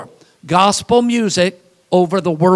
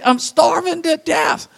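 lightly raised, higher mock woman; a hint of a woman complaining; mock woman's voice; 9 semitones higher than usual. Preacher quoting a woman, lightly higher mock voice. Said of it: "never heard a woman say".

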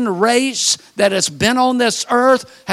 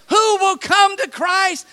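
high, slow calling to a crowd; pitched-up altar call; evangelist's appeal; 8 semitones higher, slower than usual. Retold or imitated. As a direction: send the invitation out high and drawn-out. Moody repeats his altar call.

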